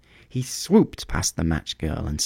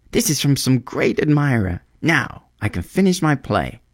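higher-pitched, pleased exclamation; happy excitement; 7 semitones higher, 3 dB louder than usual. A narrator's reading. Young playwright can finish his play, happily excited. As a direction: sound thrilled by the gift. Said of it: "He looked quite happy".

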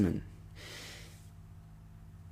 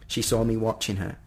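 ordinary delivery, nothing marked

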